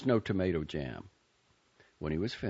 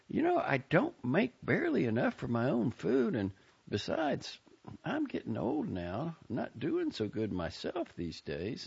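higher, motherly Southern aunt; fond aunt's farewell; 9 semitones higher than usual. A narrator's reading. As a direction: send him off, pitched up and fond. Aunt Maisie voiced by reader.

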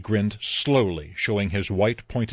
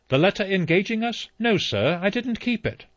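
flustered butler; 11 semitones higher than usual. Acted. Rogers flustered answering.

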